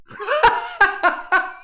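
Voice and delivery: high burst of laughter; real laughter. Real, not acted. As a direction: crack up at a punchline. Laughs after the cookie punchline.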